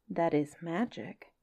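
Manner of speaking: lively storybook reading with playful lilt; story narration. A narrator's reading. Reading a Kipling story to listeners, lively storybook voice. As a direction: read it like a bedtime tale to children. Reads Just So story aloud.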